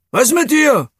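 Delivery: high, loud call from the goal; the goalkeeper calls over to the boy, loud and high. A narrator's reading. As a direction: shout it across to the hurt player. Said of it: "rief Hosenmann aus dem Tor".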